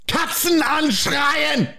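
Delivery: high, drawn-out German shout; mock German screaming. Host shouting a quiz phrase, slow mock German scream. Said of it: "you're just screaming".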